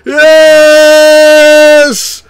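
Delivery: very high, loud triumphant shouts; excited shouting. Real, not acted. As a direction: yell with joy at the homer. Celebrating a home run.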